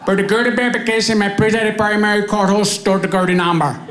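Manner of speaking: put-on fast agent script in Indian accent; Indian call-centre accent. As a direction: rattle off the script like a phone agent. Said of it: "customer service representative".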